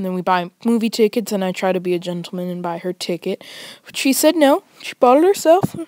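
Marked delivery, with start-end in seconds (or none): none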